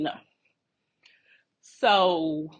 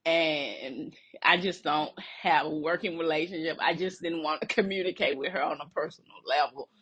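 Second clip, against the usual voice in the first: usual speaking voice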